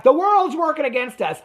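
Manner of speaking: high, plaintive mock whine; whiny Letitia. Recapping Letitia complaining the world is against them, high mock whine. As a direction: pitch it up and sound hard done by. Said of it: "Letitia was like".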